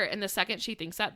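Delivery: ordinary delivery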